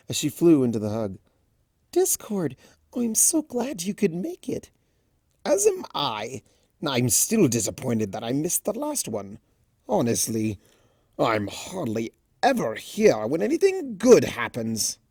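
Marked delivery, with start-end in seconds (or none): 1.9-4.7 high, breathy whispered Pegasus voice; shy and delighted, barely above a whisper; timid Fluttershy. Acted. 5.5-15.0 lightly voiced theatrical trickster; a hint of a grand showman, mock disappointed; playful spirit of chaos complaining; Discord voice.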